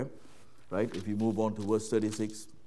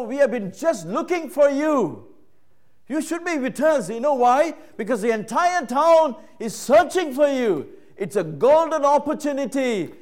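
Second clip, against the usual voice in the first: higher, louder exasperated calling; frustrated Peter; 13 semitones higher, 11 dB louder than usual. Retold or imitated. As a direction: Peter pressing Jesus, annoyed and urgent. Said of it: "He's frustrated".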